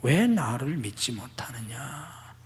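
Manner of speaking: slow voiceless whisper; soft whisper. Shown as a demonstration. Jesus' meaning whispered slowly to the congregation. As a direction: let it fall to a slow hush. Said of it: "주님의 이 말씀은 곧".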